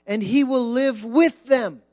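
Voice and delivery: solemn, swelling Bible reading over music; scripture reading. Acted. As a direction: proclaim the verses from the pulpit.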